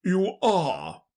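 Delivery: emphatic king voice; insistent king. Acted. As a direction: retort as a storybook king. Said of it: "said the king".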